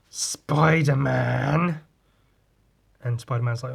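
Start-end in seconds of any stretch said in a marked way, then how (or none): 0.1-1.8 very slow, stretched-out name; drawn-out hailing. Retold or imitated. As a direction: hail the hero, dragging the name out long. Acts Silver Surfer's greeting.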